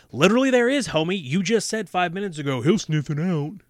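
loud, high, indignant outburst; exasperated heckling. Real, not acted. Reader heckling a clueless horror character, loud exasperation. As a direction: argue back loudly at the character.

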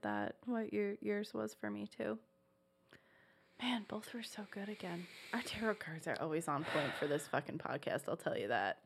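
hushed whisper, barely voiced. Real, not acted. Whispering a confession to her cohost on the podcast. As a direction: lean in and whisper it.

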